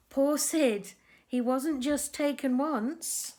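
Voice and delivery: lively storybook narration for little ones; reading to children. A narrator's reading. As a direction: tell the tale to a group of kids. Reads picture book to children.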